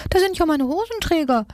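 very high-pitched mock shout; storybook cry. A narrator's reading. Nagezahn spots the missing braces, high exclaiming cry. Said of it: "schrie Nagezahn".

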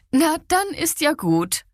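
high-pitched, breezy relief; lightly acted good cheer; cheerful again. A narrator's reading. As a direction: let the good mood lift the voice high. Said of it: "wieder bestens gelaunt".

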